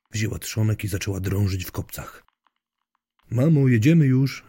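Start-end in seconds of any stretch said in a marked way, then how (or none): 3.3-4.4 raised, louder call to someone away. A narrator's reading. Daughter calls to mother from the window, calling out. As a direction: call out higher, voice carrying. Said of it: "zawołała córka z okna".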